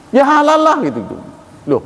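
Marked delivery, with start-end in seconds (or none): 0.1-0.8 vendor snapping. Retold or imitated. Meatball vendor snapping at a questioning customer, annoyed. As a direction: toss it back like an offended seller. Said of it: "Marah dia".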